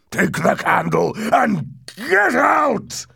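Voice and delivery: loud, slow, strangled cry; choked voice. A narrator's reading. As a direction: force it out as if about to cry. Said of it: "he said in a choked voice".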